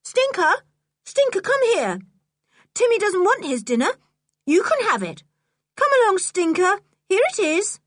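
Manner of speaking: high, carrying call to a dog; high-pitched, insistent summons; loud calling. Acted. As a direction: call the dog over from across a yard. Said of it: "called to him in a loud voice".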